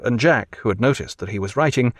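usual speaking voice

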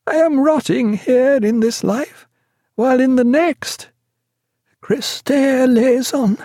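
high, slow feverish prayer; plead, then drift into prayer; terrified patient begging not to be left; delirious pleading. A narrator's reading.

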